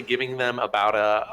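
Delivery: ordinary delivery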